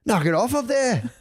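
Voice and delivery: high, slow Aussie scold; Australian accent. Retold or imitated. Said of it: "Is that your Australian accent?".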